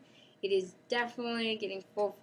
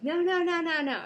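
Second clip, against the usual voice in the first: high, louder no no no; mock protest; 9 semitones higher, 6 dB louder than usual. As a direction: stop the cat, half laughing. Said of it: "And you're like".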